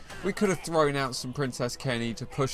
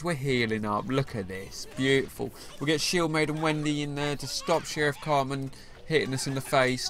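ordinary talk, nothing marked

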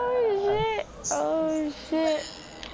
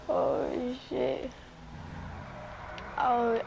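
usual speaking voice

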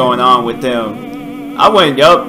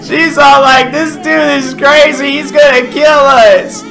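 higher and louder, still laughing; laughing while talking; 10 semitones higher, 5 dB louder than usual. Real, not acted. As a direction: talk while still cracking up. Talking right after laughing fit.

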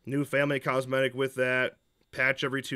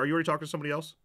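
normal talking voice

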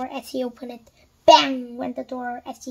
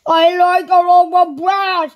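slow, loud, high shout; 5 semitones higher, 11 dB louder than usual. A narrator's reading. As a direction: shout the order with drawn-out force. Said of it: "Mister Noisy shouted".

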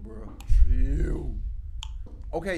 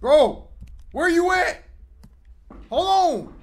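scared calling out; 13 semitones higher, slower than usual. Real, not acted. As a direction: call out, spooked. Scared searching in horror game.

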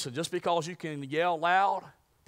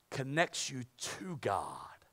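hushed emphasis; 2 semitones lower, 5 dB quieter, much slower than usual. Acted. Preaching key point.

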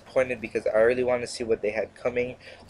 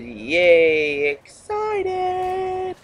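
sarcastic squeaky high yay, dragged out; mock excited squeal; much slower than usual. Gamer mocking the hype with a high squeal. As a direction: fake a giddy squeal you don't mean. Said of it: "not really excited".

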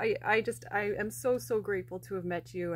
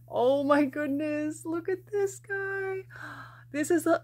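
much higher, slow, gushing over a surprise; squealing surprise at a bonus plush; delighted squeal; 7 semitones higher, 4 dB louder than usual. Real, not acted. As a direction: coo over something adorable you didn't expect. Surprise extra fox in package.

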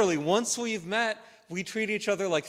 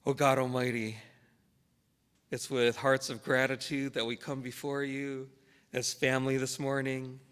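prayer; 3 semitones lower than usual. Real, not acted. Pastor praying to God for the gathered church family, reverent prayer. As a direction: pray unhurriedly, letting the voice settle lower at the close.

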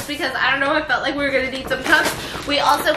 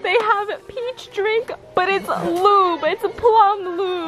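laugh-crying; 7 semitones higher, slower than usual. Real, not acted. Mock-whining about lube packaging.

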